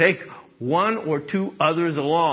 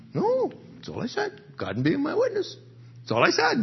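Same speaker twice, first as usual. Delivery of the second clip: lightly voiced, higher, whiny claim of innocence; mock innocent protest; 12 semitones higher than usual. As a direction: play the wronged innocent, half-joking.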